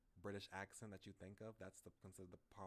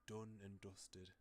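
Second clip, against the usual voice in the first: slow Northern English vowels; Northern English accent; much slower than usual. Shown as a demonstration. Demonstrating the game hero's phrase, Northern English accent. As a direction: DONE and DUSTED with a short Northern English "oo". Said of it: "in Northern England, they pronounce".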